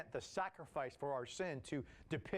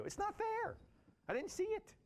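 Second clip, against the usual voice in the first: put-on very high, childish protest; whiny complaint. Thomas protesting to the apostles, high and childish. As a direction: sulk like a left-out kid.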